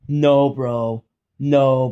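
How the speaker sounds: loud, exasperated no's; frustrated yelling. Real, not acted. Streamer yelling at his dead camera, loud frustrated no. Reacting to lost footage.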